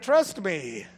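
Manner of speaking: ordinary delivery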